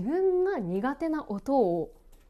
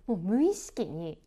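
ordinary delivery

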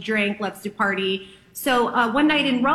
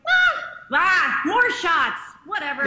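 excited, higher, brushing off danger; carefree party voice; 5 semitones higher than usual. Retold or imitated. Actress to friends, shrugging off candles, carefree and higher. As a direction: toss it off like a party girl. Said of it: "I'm like".

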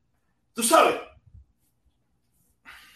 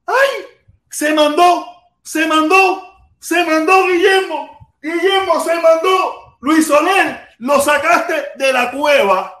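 high, loud, slow celebratory shouts; excited shouting; 8 semitones higher, 4 dB louder, much slower than usual. Real, not acted. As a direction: cheer it out big.

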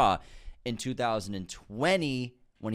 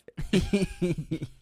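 high-pitched giggle; real, high laughter; 8 semitones higher than usual. Real, not acted. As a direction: giggle at the joke. Laughing after joking exchange.

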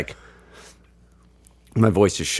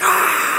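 crowd cheer. Retold or imitated. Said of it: "everyone's like".

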